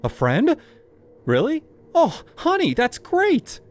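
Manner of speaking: high, loud thrilled surprise; overjoyed mother hearing good news; delighted mom. Acted. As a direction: gush like a relieved mother.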